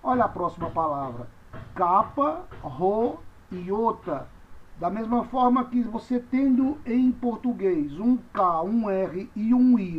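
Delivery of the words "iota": ordinary talk, nothing marked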